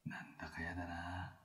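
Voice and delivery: slow uneasy whisper. Real, not acted.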